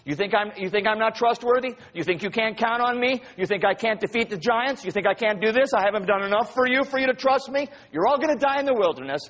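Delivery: high, insistent rhetorical challenge; acted indignation, pitch climbing; indignant rebuke. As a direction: fire off each question like an offended authority. God rebuking the adults, acted.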